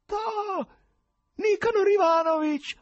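very high, loud theatrical cry; quavering tenor shout. A narrator's reading. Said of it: "urlò l'ospite inatteso con tremolante voce tenorile". (urlo con tremolante voce tenorile)

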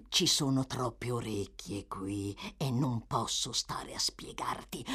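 sussurro. Acted.

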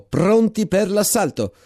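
captain shouting the order, drawn out; shouted order. Acted. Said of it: "gridò". (ordine gridato, lento)

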